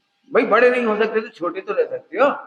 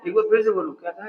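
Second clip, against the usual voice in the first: usual speaking voice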